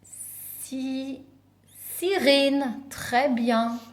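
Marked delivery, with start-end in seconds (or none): 0.0-1.2 "Si-" held long and soft, left open; very soft, lingering "Si-" as a cue; unfinished first syllable. Shown as a demonstration. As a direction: stretch "Si-" for children to finish. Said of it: "une petite qui s'appelle".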